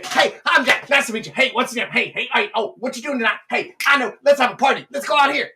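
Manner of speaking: hammed-up manic introduction; over-eager. Overexcited guy walking up to a girl, jittery.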